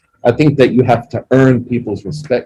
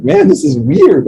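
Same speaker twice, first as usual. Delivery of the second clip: retold puzzlement; 9 semitones higher, 5 dB louder, slower than usual. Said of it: "And I was like".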